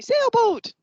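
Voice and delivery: high, loud chorus-like cheer; kids shouting answer. Acted. Kids guessing the turtle's word, excited high shout. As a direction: call out "Sailboat" high and quick. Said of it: "They'll say".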